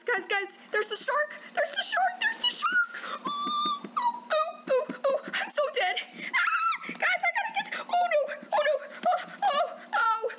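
very high, frantic squeals of panic; real game panic, piercing high cries; panicked shrieking. Real, not acted. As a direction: shriek like the shark is on you. Shark attacks player in game.

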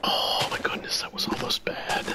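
breathless relieved whisper. Real, not acted. Relief after nearly dying.

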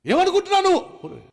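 very high-pitched imitation yell; mock prayer shouting. Preacher mimics people who scream at God, high mock shout. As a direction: cry out shrilly like someone quarrelling with God. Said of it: "అరుపులే అరుపులు, కేకలే కేకలు".

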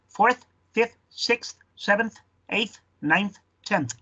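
"fourth" to "tenth" with brief, clean th endings; native-like th closing "ninth", "tenth"; natural final th. Shown as a demonstration. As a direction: run through "sixth", "seventh", "eighth" with th quickly released. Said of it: "cuando lo digas rápido ya no va a sonar ridículo".

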